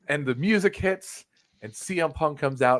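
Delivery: usual speaking voice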